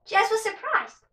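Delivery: plain reading voice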